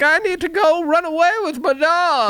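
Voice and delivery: very high falsetto girl impression; hammed-up high-pitched plaint; mock-wailing Dorothy. Dorothy answering her scolding aunt and uncle, mock falsetto wail. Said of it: "And she's like".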